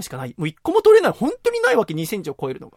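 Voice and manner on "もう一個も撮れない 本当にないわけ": exasperated. Real, not acted. Exasperated at finding no clams.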